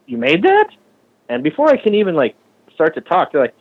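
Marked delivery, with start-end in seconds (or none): 0.1-0.7 squeaky high incredulous question; mock disbelief. Doubter amazed he built the bike, high mock disbelief. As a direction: act out their shock.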